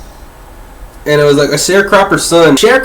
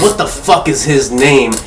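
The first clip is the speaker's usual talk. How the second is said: ordinary delivery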